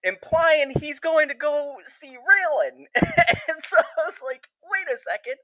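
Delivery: high, excited laugh-talk; giddy laughing. Real, not acted. Fan realising he'll go see Raylan, high giddy laughing. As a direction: let laughter break into high-pitched disbelief.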